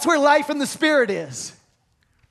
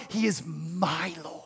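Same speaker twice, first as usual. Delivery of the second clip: soft intimate whisper, almost breathless; 7 semitones lower, 9 dB quieter than usual. Real, not acted. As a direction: breathe it like a secret to the heart. Intimate personal confession.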